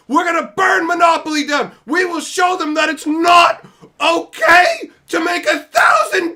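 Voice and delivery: mock fanatic screaming high and loud; mock crusade scream, voice cracking near tears; hammed-up outraged screaming. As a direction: rant like an unhinged crusader, shrieking. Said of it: "freaking out about a thousand dollar version".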